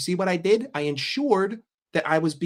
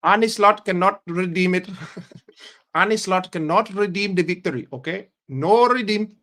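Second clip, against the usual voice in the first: higher-pitched mock Indian accent; comic Indian accent, raised voice; 4 semitones higher, 3 dB louder than usual. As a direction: protest in a hammed-up Indian accent. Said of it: "you'll think I'm fucking Indian".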